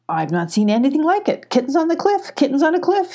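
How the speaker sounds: high excited goat chatter. A narrator's reading. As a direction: babble on in a high amazed goat voice. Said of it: "he chattered on".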